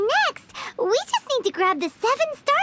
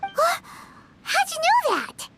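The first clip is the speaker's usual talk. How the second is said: higher, startled question; 7 semitones higher than usual. Acted. Paimon startled that the old man knows, surprised. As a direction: caught off guard by the stranger.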